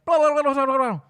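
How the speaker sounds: high, loud blur of syllables; mock someone babbling unintelligibly; gibberish speaker voice. Drive-thru worker carrying on to the customer, garbled babble. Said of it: "the guy talks like that".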